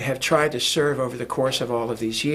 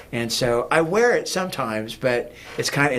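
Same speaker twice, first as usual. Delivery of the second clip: normal talking voice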